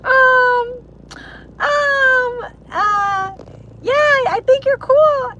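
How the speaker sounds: high, slow hesitant squeak; imitating a nervous friend's high awkward praise; awkward mock fan. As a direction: stammer shyly before a compliment. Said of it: "You guys are gonna be like".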